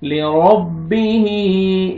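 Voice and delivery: लिरब्बिही slow, final ही voiced clearly. Shown as a demonstration. Teacher modelling the letter ha. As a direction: draw out लिरब्बिही with a distinct ha. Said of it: "हा का आवाज आना चाहिए".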